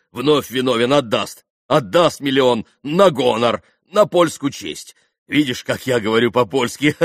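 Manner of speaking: loud, hammed-up Polish-ish apology; mock Polish. A narrator's reading. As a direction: play at being a gallant Pole. (громкая шутливая польская речь)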